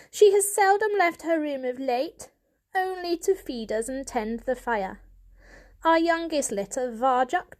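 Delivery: a hint of a worried old cat; lightly voiced elder cat. A narrator's reading. Elder Poor warns the family, a hint of worry.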